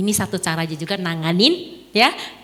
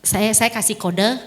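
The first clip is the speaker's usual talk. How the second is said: ordinary delivery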